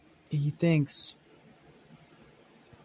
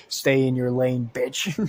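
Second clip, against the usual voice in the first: repeated louder; 6 dB louder, slower than usual. Acted.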